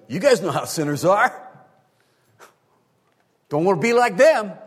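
high, loud goading shout; baiting the audience. Acted. Preacher voicing Jesus goading his listeners about sinners, baiting shout. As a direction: egg the crowd on at full, high volume. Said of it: "He's literally baiting his audience here".

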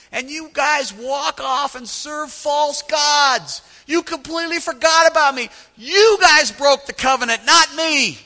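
high, loud, hammered shouting; furious divine rebuke. Acted. God rebuking Israel over the covenant, furious shout. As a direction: thunder the accusations, stretching key words.

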